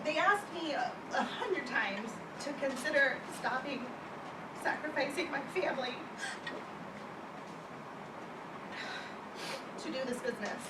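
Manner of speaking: real tears held in while telling her story; holding back tears. Real, not acted. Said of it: "no idea I was gonna cry".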